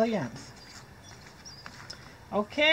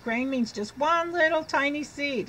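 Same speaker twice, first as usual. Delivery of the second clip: ordinary delivery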